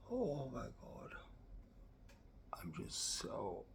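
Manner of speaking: breathless whisper of awe; hushed, amazed whisper; awed whisper. Real, not acted. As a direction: whisper it like you can't believe it. Awed whisper over makeup.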